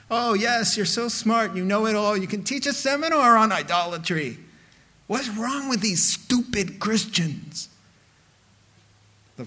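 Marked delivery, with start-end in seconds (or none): 0.1-7.7 higher-pitched know-it-all sarcasm; mock-admiring, turning to scorn; mock smugness. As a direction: play the puffed-up expert scorning others. Mocking the know-it-all believers.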